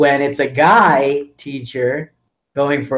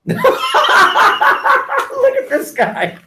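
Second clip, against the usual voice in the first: high cackling laugh into the words; helpless laughter spilling into speech; laughing hard; 4 dB louder, much slower than usual. Real, not acted. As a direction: crack up, then point him out. Cracking up at friend's disguise.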